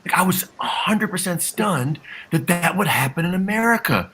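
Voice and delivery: high-pitched, stretched-out mock disbelief; facetious astonishment. Panelist pretending to be stunned by racism in America, facetious. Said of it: "that was me being facetious the entire time".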